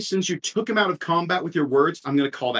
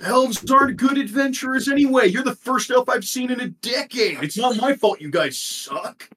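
high, scornful troll voice; dismissive troll; 9 semitones higher than usual. Acted. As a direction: sneer at the elves from up high. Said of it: "He goes".

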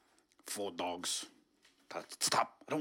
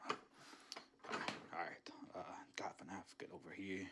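soft lower whisper; 7 semitones lower, 11 dB quieter, slower than usual. Acted. Intruder in the kitchen whispering to himself. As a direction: murmur it while sneaking.

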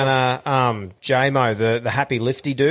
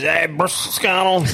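put-on higher, slurred drunk slang; blind-drunk Aussie greeting; 5 semitones higher than usual. Cam greets another Aussie on the smokers' deck, blind drunk. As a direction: run it together like a drunk mate. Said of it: "Cam comes up blind".

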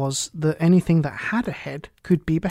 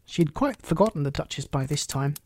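ordinary delivery, nothing marked

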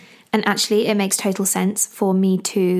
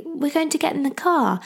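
put-on higher-pitched parent explaining to a baby; talking to baby; 8 semitones higher than usual. Shown as a demonstration. Mum telling baby about the car, baby-directed voice. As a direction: pitch it up for a little one. Demonstrating how she talks to baby.

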